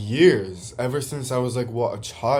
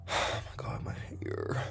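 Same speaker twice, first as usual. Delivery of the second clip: barely voiced whisper; real hushed mutter of dismay; whispered aside; 12 dB quieter, slower than usual. Real, not acted. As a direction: whisper it to yourself, exasperated. Aside to herself about hair.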